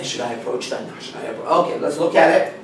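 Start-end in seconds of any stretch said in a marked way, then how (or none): 0.0-1.5 doubtful inner voice. Retold or imitated. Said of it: "You say".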